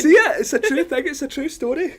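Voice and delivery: high, loud, laughing through it; laughing protest. Real, not acted. Scottish reporter laughing as his co-host says she sees him going red. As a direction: insist it's true while cracking up.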